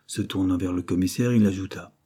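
ordinary delivery, nothing marked

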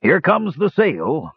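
slow, high-pitched warning; strained warning. A narrator's reading. Dwarf captain throwing himself down, drawn-out warning. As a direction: draw out the alarm as it approaches. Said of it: "he grunted".

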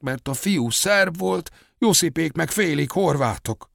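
high-pitched aunt telling her memories; village aunt recounting. A narrator's reading. Voices village aunt Sára's testimony.

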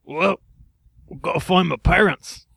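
high put-on English bird; unconvincing English accent. Bird explains about angry parents, high English accent. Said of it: "very Englishmen".

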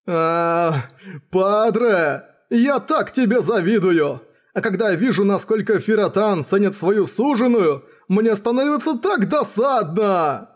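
high, envious lament; jealous spear hero. Acted. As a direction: whine with envy of a rival.

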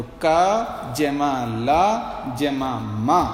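very slow syllable-by-syllable का जमा ला जमा मा; child spelling out. Retold or imitated. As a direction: sound out letters like a small child learning to read. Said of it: "जैसे छोटा बच्चा होता है ना". (बच्चे की तरह बहुत धीरे अक्षर जोड़ते हुए)

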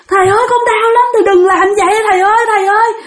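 high, pained pleading; wailing for mercy. Retold or imitated. Hurt spirit pleading with the master to stop, wailing. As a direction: imitate a desperate begging wail. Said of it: "Mà gào thét tôi ghê lắm".

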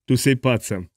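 plain reading voice

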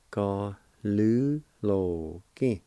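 slow and careful; slow syllables. Shown as a demonstration. Teacher slowly modelling the word for students. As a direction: say each syllable slowly and clearly. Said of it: "And it's pronounced like this".

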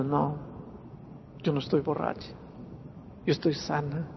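very soft, retold meek protest; subdued tearful denial. Wife insisting to her husband she is not drunk, subdued tearful denial. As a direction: answer small and quiet, a touch of tears. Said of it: "dijo llorando".

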